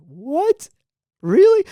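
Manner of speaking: high-pitched, rising surprise; mock disbelief. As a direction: retell your shock, pitch leaping up. Reacting to being told he snored.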